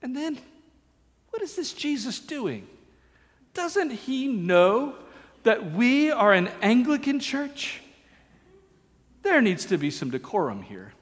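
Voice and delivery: clutch your pearls at Jesus' guests; mock scandalized Pharisee. Preacher voices Pharisees shocked at Jesus, high prissy mock gasp. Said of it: "they grumbled".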